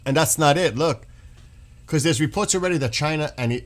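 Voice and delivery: ordinary talk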